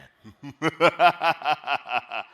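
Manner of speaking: loud burst of real laughter; laughing. Real, not acted. Emcee laughs after his deep-voice attempt. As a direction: crack up out loud.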